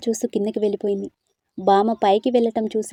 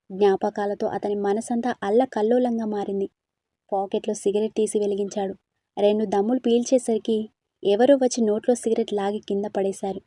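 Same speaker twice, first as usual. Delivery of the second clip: usual speaking voice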